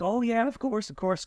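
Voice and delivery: high-pitched, hurried-to-oblige retold reply; sudden apologetic agreement. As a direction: act out suddenly realising he needs a break. Retelling apologetic yes to sweating actor.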